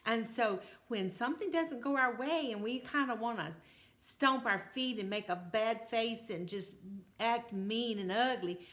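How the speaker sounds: ordinary delivery, nothing marked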